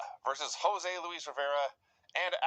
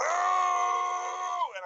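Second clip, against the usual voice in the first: howling with laughter; 4 dB louder than usual. Real, not acted. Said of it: "I'm howling with laughter".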